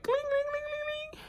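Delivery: very high cling sound effect; ping each one like metal falling; high tinkling buttons. Retold or imitated. The boxer drops the bullies' buttons, high tinkling sound effect.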